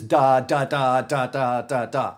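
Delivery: every "da" hit hard and separate; choppy syllables like hammer blows; banging out every syllable. Shown as a demonstration. Teacher showing students choppy over-articulation. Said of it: "banging out every syllable".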